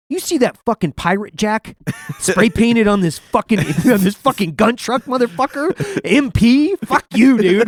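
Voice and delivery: high, loud incredulous rant; retold outrage. Gunner answering a guy who called them MPs, outraged rant. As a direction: re-enact blowing up, half laughing at it. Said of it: "fucking really sent me through the roof".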